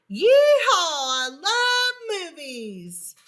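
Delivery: loud, high, slow whoop of joy; loud joyful exclaim. Acted. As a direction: whoop it out like a cowboy. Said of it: "every time he exclaimed".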